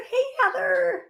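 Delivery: usual speaking voice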